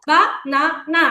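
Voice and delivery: বা, না, না with a gap after each; clapped-out syllables. Shown as a demonstration. Teacher giving one clap per part, বা না না syllable by syllable. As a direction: count out বা-না-না in three distinct beats. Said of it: "part আছে ততগুলো আমরা clap দিব".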